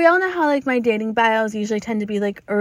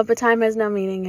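ordinary talk, nothing marked

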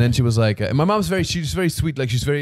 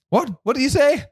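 mom's voice; 13 semitones higher, 4 dB louder than usual. Retold or imitated. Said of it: "it means, like".

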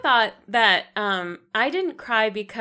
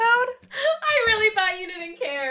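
laughing; 11 semitones higher, slower than usual. Real, not acted. Laughing repeat of claim.

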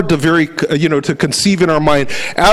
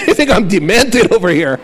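laughing; 3 semitones higher than usual. Retold or imitated. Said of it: "I got this stupid grin".

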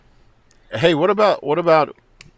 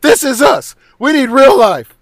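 loud, higher, laughing protest over watching TV; laughing mock rant; 8 semitones higher, 8 dB louder than usual. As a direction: shout it like a fake argument, cracking up as you go. Acted quarrel about watching TV.

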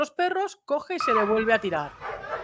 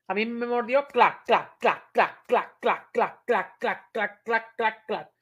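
biting sound effect; slower than usual. Shown as a demonstration. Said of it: "es como va a morder".

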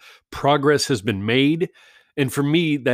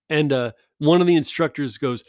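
ordinary talk, nothing marked

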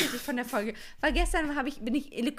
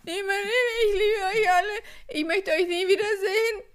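high, loud tearful wail; drunk crying; 8 semitones higher, 7 dB louder than usual. Retold or imitated. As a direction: wail it like a weepy drunk granny. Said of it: "ich mach diese Nummer".

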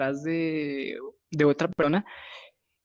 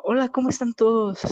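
a lightly voiced friend, higher and louder; cheery greeting; 6 semitones higher, 4 dB louder than usual. Friend walking in greeting the whole group, lightly voiced. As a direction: breeze in and greet the room brightly.

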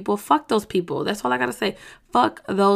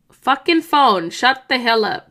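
louder irritated snap at the phone; real irritation at the interruption; annoyed at phone; 2 semitones higher, 4 dB louder than usual. Real, not acted. Snapping at her ringing phone mid-video, annoyed.

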